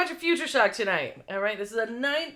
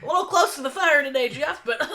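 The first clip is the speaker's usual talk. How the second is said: choking reporter; 6 semitones higher, 5 dB louder than usual. Pretend. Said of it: "She's like".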